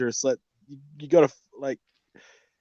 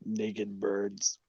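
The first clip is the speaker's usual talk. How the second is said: whisper; 4 semitones lower, 9 dB quieter than usual. Real, not acted. Whispered echo.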